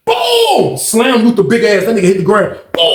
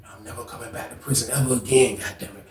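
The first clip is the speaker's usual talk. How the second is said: muttered vow; 7 semitones lower, 12 dB quieter than usual. Retold or imitated. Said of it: "I'm standing back looking like".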